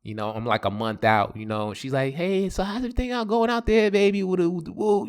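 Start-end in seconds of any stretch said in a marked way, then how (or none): 2.1-5.1 lightly mimicked girlfriend, higher and quicker; higher-pitched chatty check-in; imitated girlfriend. Girlfriend checking in on her man, lightly imitated.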